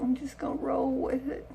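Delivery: soft breathy aside; whisper. Real, not acted. Telling viewers she'll accept looking older, whispered. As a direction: whisper it like a secret. Confides aside about aging face.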